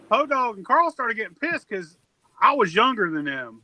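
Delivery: ordinary talk, nothing marked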